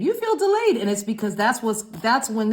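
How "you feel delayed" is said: "You feel delayed" jumped far higher and louder, stress on "delayed"; squeaky emphasis. Shown as a demonstration. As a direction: repeat it in a sudden high squeal.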